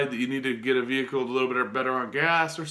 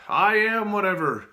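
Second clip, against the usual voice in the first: higher and louder, with conviction; 5 semitones higher, 4 dB louder than usual. Shown as a demonstration. Urging viewers to say it loud, with conviction. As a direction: declare it like you believe it. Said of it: "Say it out loud".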